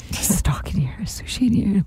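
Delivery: hushed whisper at night; husband whispering into the dark bedroom. Retold or imitated. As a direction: whisper it so you don't wake anyone. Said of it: "He's like".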